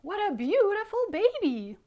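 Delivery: high, loud, gushing shout; over-the-top delighted storybook yell; very excited. Acted. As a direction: exclaim like a thrilled bird dad. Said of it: "Mister Bird was very excited".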